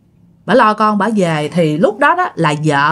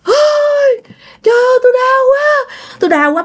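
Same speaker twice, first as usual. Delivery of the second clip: crying out in pain. Acted. Thắng, head bleeding, wailing that it hurts so much. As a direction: cry out as if his head is splitting. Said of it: "vừa la, vừa hét".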